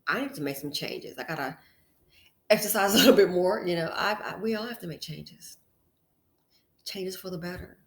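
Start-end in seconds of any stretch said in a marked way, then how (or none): none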